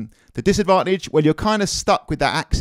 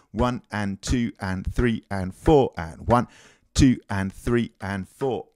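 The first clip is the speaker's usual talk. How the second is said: slow, softer counting along with the beat; 2 semitones lower, 6 dB quieter, slower than usual. Shown as a demonstration. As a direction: count slowly under the drums. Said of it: "counting in time while playing".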